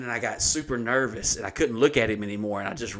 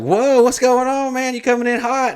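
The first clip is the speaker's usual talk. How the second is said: excited greeting; 13 semitones higher, 7 dB louder than usual. Retold or imitated. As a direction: imitate a hyped-up desk guy hollering hello. Desk guy greets excited teller.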